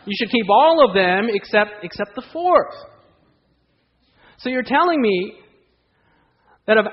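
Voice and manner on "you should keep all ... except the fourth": higher, louder hammed-up discount pitch; mocking sales-pitch voice rising high at the end; mock salesman offer. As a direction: sell it like a cheesy deal. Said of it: "But they say".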